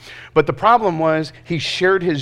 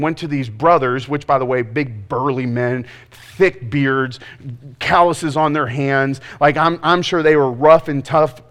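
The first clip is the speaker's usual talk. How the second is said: ordinary delivery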